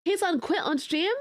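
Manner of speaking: ordinary delivery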